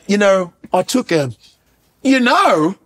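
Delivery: imitated hesitant British accent. Second British actor talking out of character, mock British accent. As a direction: put on a halting British interview voice. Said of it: "And he's like".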